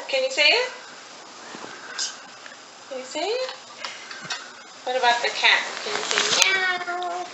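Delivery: singsong picture-book voice; reading to a toddler. Acted. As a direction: read it to a toddler.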